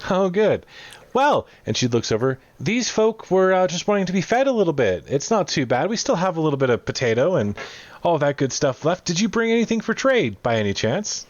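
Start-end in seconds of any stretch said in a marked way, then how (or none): 0.1-1.5 higher-pitched, relieved cook. Acted. Cook hears they are not one of them, high relief. 2.6-11.2 higher-pitched, lively cook voice; chatty cook character. Acted. Cook asks visitors for trade, high and chatty.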